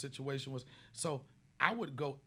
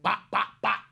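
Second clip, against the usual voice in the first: mock gunshots; 5 semitones higher, 12 dB louder than usual. Imitating gunfire in a bit.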